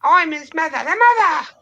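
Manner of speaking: Monty Python mother shrieking; shrill mother. Acted. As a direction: squawk like an outraged mum. Life of Brian mother impression.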